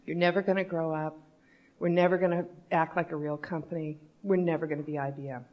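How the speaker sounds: composed, quiet restatement; calm. Retold or imitated. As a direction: repeat it calmly, with pauses. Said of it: "say that again just a little calmly".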